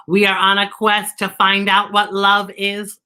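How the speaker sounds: even, measured robot voice; monotone robot Z. Acted. Robot replying to the crow about the quest, in an even monotone. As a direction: keep every word on one note. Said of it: "And Z replies".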